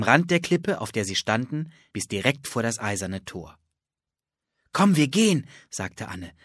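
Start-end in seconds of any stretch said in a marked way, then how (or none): 4.7-5.5 eager urging. Acted. Anne urging her brother on.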